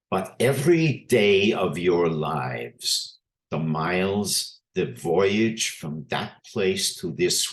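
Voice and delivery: put-on a lecturer doing an old-country rabbi's Eastern European accent with weighty pauses. Lecturer reads the rabbi's eulogy to the class, in an Eastern European accent. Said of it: "I'll even do a little bit, a bit of an accent here".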